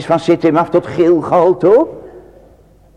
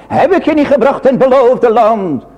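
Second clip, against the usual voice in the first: higher, louder, rising preacher's plea; God's pleading voice; 9 semitones higher, 4 dB louder than usual. Acted. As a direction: plead as the Lord to his people. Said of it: "De Heere gaat zelf antwoord geven". (hoger en luider smekend, als de Heere)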